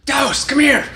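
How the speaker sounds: very high mock holler; imitated scream. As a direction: imitate a daughter yelling for someone to come. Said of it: "she screams it all the time".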